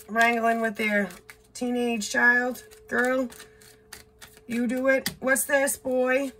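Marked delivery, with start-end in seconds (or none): none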